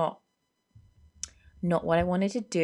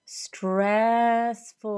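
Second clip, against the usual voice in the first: STRESSFUL stretched long, higher, swelling louder; drawn-out STRESSFUL; 6 semitones higher, 4 dB louder than usual. Real, not acted. Podcaster on working for herself, STRESSFUL drawn out high. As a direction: wail out "stressful" in two long syllables. Dramatises how work feels.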